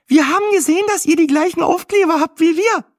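very high, loud, chirpy woman's voice; excited chattering. A narrator's reading. Weather presenter gushing over the stickers, excited chatter. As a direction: chatter on like a startled hen. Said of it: "schnatterte sie aufgeregt".

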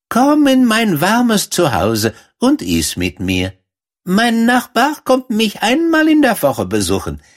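hohe, freundliche Mäusestimme; Feldmaus. A narrator's reading. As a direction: eifrig plaudernde kleine Feldmaus. Erzählerin spricht die Feldmaus. (hohe, gesprächige Mäusestimme)

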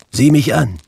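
high, loud spirit's command; commanding ghost. Acted. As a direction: order him to look.

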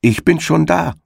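high, triumphant call across the field. Acted. Hedgehog's wife calls to the arriving hare, high and triumphant. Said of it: "rief ihm die Frau des Igels entgegen".

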